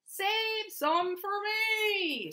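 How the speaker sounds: high, long-drawn singsong plea; farmer's cry. A narrator's reading. Farmer begging animals to leave him apples, drawn-out cry. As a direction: stretch every word high, as a storybook farmer calling out. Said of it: "cried the farmer".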